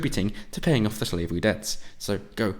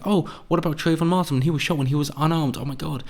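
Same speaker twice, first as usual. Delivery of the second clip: higher, theatrical shocked voice; mock outrage; 7 semitones higher than usual. Mocking an activist's Trayvon argument, higher theatrical outrage. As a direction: overplay the outrage.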